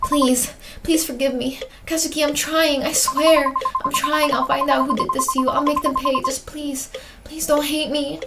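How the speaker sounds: acted tearful, fervent prayer; fervent pleading. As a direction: beg urgently, fading to a breath at the end. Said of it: "you prayed fervently".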